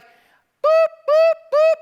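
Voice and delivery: a very high, loud electronic beep; warning beep. Retold or imitated. Preacher imitating a car's warning beep, loud. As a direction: chime like a dashboard warning light.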